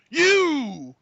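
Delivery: high, loud, stretched call-out; hammed-up drawn-out yell; mock accusing shout. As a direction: holler it like a group pointing someone out. Acts out crowd singling someone out.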